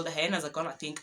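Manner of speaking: usual speaking voice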